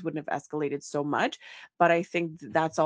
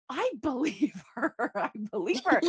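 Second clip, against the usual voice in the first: laughing disbelief; 5 semitones higher than usual. Retold or imitated. Quoting her stunned reaction.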